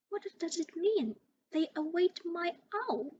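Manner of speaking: high, startled stammer; a boy stumbling over his question. A narrator's reading. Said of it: "he stammered".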